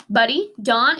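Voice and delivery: usual speaking voice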